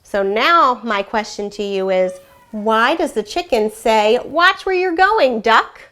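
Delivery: lively, expressive storybook reading with animal voices; story read aloud to children. A narrator's reading. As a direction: read it like a classroom read-aloud, bringing each animal to life. Teacher reads picture book aloud.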